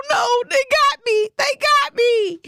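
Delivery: high, loud hammed-up wail; mock wail. Vlogger hamming up catching coworkers' cold, high mock wail. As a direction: wail it like a fake tragedy. Realizing she caught the cold.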